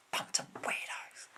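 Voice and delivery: whispered insult under the breath; muttered whisper. Real, not acted. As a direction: hiss it aside, scornful. Whispered insult after parody.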